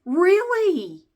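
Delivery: high, loud disbelieving really; shocked, can't believe it; incredulous really. Real, not acted. Reacting to ex's name tattoo, incredulous.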